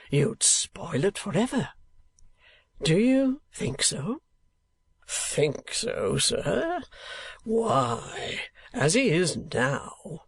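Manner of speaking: high, rising showman's indignation; a lightly voiced actor-manager in full flow. A narrator's reading. Manager scoffs at Nicholas's wish, mildly horrified.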